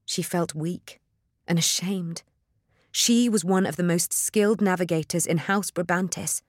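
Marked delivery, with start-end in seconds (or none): none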